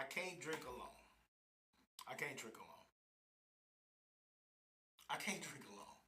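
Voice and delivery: low, soft, quick-running plea; quiet, low appeal for company; subdued pleading. Real, not acted. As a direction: sink right down and plead quietly. Wearily pleading for drinking company.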